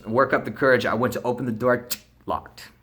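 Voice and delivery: plain reading voice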